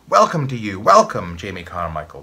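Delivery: loud chorus of lads; crying out together. Acted. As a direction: call it out as a whole classroom. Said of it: "cried the lads".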